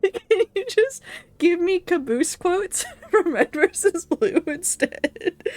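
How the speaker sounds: asking again, high and slow, breaking into laughter; giggling so hard the words stretch out; laughing through it. Real, not acted. Streamer repeating request to game, laughing high and slow.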